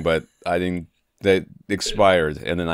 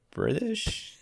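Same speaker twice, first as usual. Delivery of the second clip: British drawn up much higher in a put-on British accent; mock British accent; 8 dB quieter than usual. Host joking to co-host in a hammed-up British accent. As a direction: fake British accent, pitched high. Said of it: "'cause I'm".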